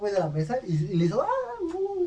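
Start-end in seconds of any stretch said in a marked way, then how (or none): 1.2-2.0 imitating crying. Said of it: "empieza a llorar el vato".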